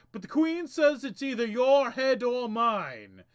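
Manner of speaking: loud, apologetic henchman; a brash knave with an axe. Acted. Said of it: "the Knave says".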